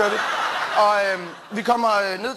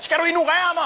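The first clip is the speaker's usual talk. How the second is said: high, challenging mock-aggressive retort; picking a fight; 9 semitones higher than usual. Brother confronting people who ignore him, mock-aggressive challenge. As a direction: bark it like a bully who wants a brawl. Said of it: "så siger han".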